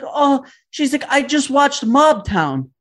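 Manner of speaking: high, slow gushing mom voice; excited mom. Retold or imitated. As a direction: savor the movie title proudly.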